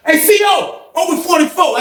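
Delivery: high-pitched holler to a guard; retold shout for the door; calling out. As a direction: call down the cell block, voice way up. Calling the guard to open cell.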